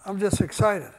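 usual speaking voice

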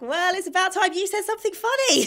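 high, loud mock retort; teasing comeback. Dele Alli hitting back at his teammate, high teasing voice.

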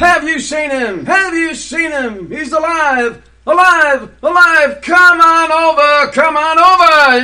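loud, high barker's cry to the crowd; carnival barker calling. Acted. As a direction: hawk it like a fairground showman.

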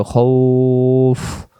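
খাউফ drawn out, final f with air escaping; airy final f. Shown as a demonstration. Teacher stretches খাউফ so students let the air out. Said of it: "শেষে ফ অক্ষরের মতো বাতাস বেরোবে".

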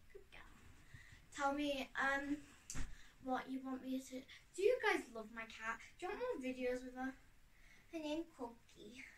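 ordinary talk

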